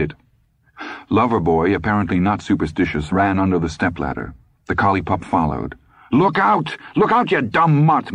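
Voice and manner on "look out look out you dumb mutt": high-pitched panicked warning shout; alarmed, angry cry, pitched way up. A narrator's reading. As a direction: yell at a dog underfoot. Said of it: "Morris shouted".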